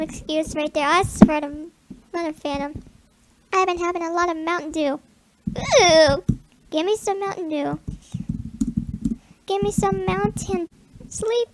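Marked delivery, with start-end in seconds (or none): none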